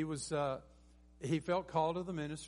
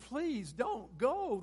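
drawn-out, high, loud begging; imploring plea; pleading; 9 semitones higher, 6 dB louder, much slower than usual. Retold or imitated. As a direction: beg them slowly, voice lifted high. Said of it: "They were begging".

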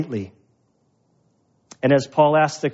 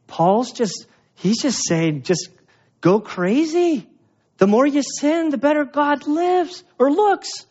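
mocking accuser; 10 semitones higher, slower than usual. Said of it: "that were saying".

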